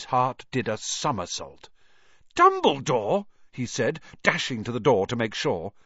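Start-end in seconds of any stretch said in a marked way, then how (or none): 2.3-3.3 high startled question; surprised. Acted. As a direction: blurt it in surprise. Harry rushes to check.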